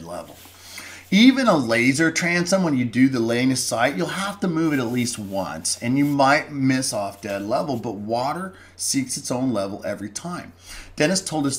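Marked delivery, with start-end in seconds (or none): none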